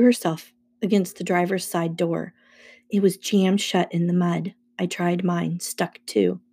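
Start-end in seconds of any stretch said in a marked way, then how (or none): none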